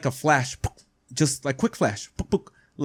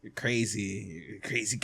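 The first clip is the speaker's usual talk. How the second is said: quiet dismissive reply; 6 semitones lower, 13 dB quieter than usual. Retold or imitated. His own dismissive reply.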